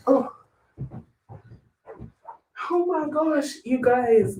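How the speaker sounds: high, soft gasp of wonder; amazed. Real, not acted. Student marvels to viewers at her score, amazed. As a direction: marvel at it, half breathless.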